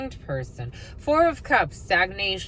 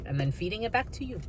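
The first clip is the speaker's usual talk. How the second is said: ordinary talk, nothing marked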